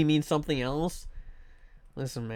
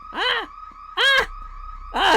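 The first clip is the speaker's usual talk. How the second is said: short, very high-pitched yelps; startled yelps; slower than usual. Real, not acted. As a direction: yelp out in alarm. Startled by something in game.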